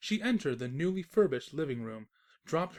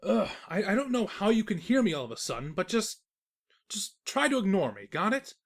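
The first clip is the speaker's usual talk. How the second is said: louder, higher, rushing to shut her up; exasperated outburst; 5 semitones higher, 7 dB louder than usual. Acted. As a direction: snap back at her, fed up and loud. Narrator loses patience with Twilight.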